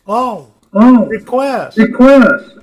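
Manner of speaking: ordinary talk, nothing marked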